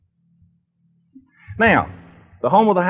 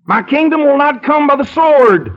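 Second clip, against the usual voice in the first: Jesus rebuking Simon; 7 semitones higher than usual. Acted. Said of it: "And our Lord grabs him by the wrist and said".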